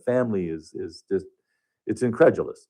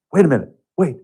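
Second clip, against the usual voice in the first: higher and louder, sudden "wait"; urgent stop; 7 semitones higher, 6 dB louder, faster than usual. Retold or imitated. Patient abruptly stopping her therapist, urgent and raised. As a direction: halt him mid-session, urgently.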